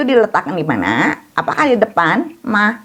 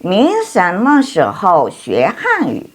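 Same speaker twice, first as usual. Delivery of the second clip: somewhat slow, smoother and less spaced out, falling on "Hanyu"; a quicker second run-through of the sentence; moderately slow reading; slower than usual. Shown as a demonstration. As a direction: read "Ni shenme shihou xue Hanyu" once through, less deliberately. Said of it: "same sentence read again".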